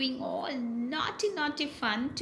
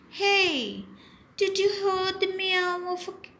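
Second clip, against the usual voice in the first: high-pitched alarmed question; scared parrot; 8 semitones higher, 3 dB louder than usual. Acted. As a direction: ask quickly in acted alarm. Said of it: "She's scared".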